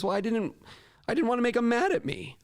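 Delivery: high, halting imitation of an excuse; defensive student. Retells student's defensive answer.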